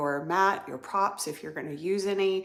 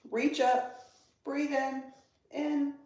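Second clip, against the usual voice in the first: higher, held notes with pauses between cues; breath-pacing cue; 8 semitones higher than usual. Real, not acted. Yoga teacher guiding students to reach up and breathe, high held tone. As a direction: pace the breath with high, suspended phrases.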